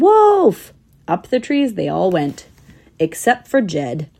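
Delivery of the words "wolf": very high, loud alarm cry; shouted wolf. A narrator's reading. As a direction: yell it so they all scramble. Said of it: "But when he shouted".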